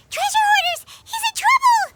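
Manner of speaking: high, loud shout of alarm; alarmed cry. Acted. Seeing the man ambushed by treasure hoarders, loud high alarm. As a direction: cry out a sudden warning.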